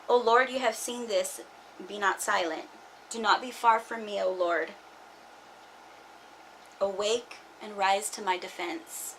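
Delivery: quiet, earnest psalm reading; scripture reading. Acted. Reading a psalm to her viewers, quiet earnest plea.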